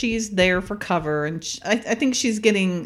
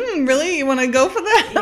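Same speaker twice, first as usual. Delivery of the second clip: higher-pitched amused disbelief, imitated; teasing imitation with a laugh behind it; mock sceptical; 7 semitones higher, 4 dB louder than usual. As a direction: voice a knowing, doubtful look. Voicing the look she gives.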